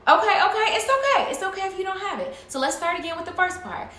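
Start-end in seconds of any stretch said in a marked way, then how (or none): 0.1-2.3 high, loud, eager reassurance; hurried reassurance. Acted. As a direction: rush to reassure the puzzled pup. Trainer reassuring a confused dog.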